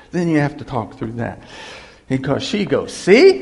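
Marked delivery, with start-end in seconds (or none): 2.9-3.4 high, loud, pointed jab; vindicated wife. Acted. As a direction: act out a wife's loud I-told-you-so. Acted wife's triumphant retort.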